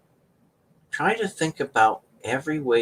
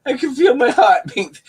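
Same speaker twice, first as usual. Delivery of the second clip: higher and louder, laughing through it; 11 semitones higher, 6 dB louder than usual. Real, not acted. Repeating the throbbing pinky story to viewers, laughing higher. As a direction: burst out laughing as you repeat it.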